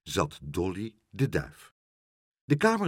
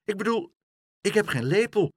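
put-on high little-girl voice, hesitant; flustered girl making excuses; stammering child; 11 semitones higher, 4 dB louder than usual. A narrator's reading. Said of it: "stamelde Aagje".

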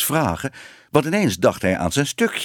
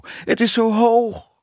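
very high, drawn-out lament; plaintive complaint; 12 semitones higher, slower than usual. A narrator's reading. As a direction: whine it slowly from up high, scared of the height. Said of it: "klaagde de juffrouw". (klaaglijk, hoog en langgerekt)

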